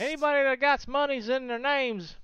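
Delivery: high-pitched hammed-up southern voice; southern accent. Streamer joking about a viewer's name, high southern accent. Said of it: "Did [name] just shout out that southern accent?".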